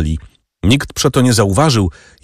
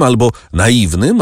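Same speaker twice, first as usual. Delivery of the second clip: ordinary delivery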